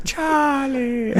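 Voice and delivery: very high, laughing unicorn voice; Charlie the Unicorn voice. Pretend. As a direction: squeal the name in a high cartoon voice. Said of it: "Charlie the Unicorn impression".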